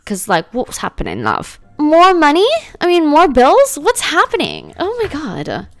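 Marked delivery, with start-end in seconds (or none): 1.8-5.7 high-pitched, incredulous complaint; exasperated disbelief. Real, not acted. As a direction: start loud and high, dismayed at the bills. Exasperated at more bills.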